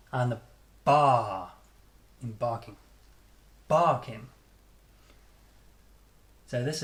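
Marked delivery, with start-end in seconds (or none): 0.8-1.5 "bar" loud and long; heavily stressed "bar"; strong syllable. Shown as a demonstration. 3.7-4.3 "Barking" with loud "Bar", weak "king"; strong-weak "Barking"; first-syllable stress. Shown as a demonstration. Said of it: "One is always much stronger".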